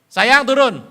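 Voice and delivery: loud, high call to the wife upstairs. Retold or imitated. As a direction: shout for her to come down. Calling his wife downstairs.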